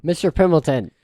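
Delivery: loud, high yell down a hallway; yelling from afar. Acted. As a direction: call out sharply to the servant across the house. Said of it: "Like, yelling from the hallway".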